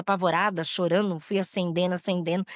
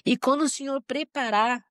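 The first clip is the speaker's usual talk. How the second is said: emotional prayer. Real, not acted. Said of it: "Essa eu vou me emocionar".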